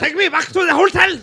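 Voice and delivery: high, shouted mock German; exaggerated German accent. German director demanding his hotel, high shouted German accent. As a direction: bellow it as a hammed-up German. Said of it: "That sounds more German".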